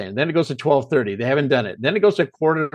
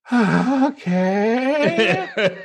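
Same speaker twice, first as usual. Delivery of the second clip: high, drawn-out hesitant okay; dubious, stretched-out "okay"; reluctant agreement; 8 semitones higher, much slower than usual. Retold or imitated. Reacting to an impossible casting request.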